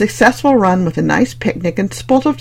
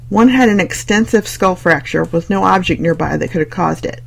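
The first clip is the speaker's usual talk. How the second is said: plain reading voice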